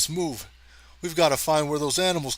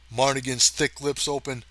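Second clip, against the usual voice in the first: plain reading voice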